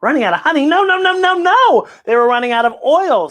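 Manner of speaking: the dog bursts in correcting; excited dog voice. Acted. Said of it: "says Applesauce the dog".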